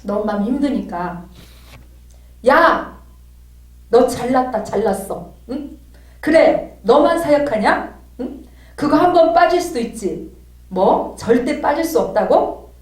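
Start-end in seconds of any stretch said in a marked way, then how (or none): 2.5-12.7 loud, higher sarcastic tirade; heated, scornful outburst, louder and pitched up; angry venting. Retold or imitated. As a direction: re-enact letting all the resentment out. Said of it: "첫 번째 저는 토설을 했습니다".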